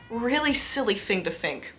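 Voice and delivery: ordinary talk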